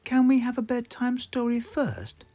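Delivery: put-on high little girl pig voice; Peppa. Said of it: "says Peppa".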